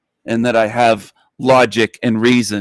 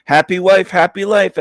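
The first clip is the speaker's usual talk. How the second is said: higher and louder, sing-song mockery of old men; mocking old men; 7 semitones higher, 3 dB louder than usual. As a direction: lift it up and mock the saying. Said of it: "sixty-year-old men saying".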